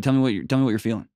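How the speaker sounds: ordinary talk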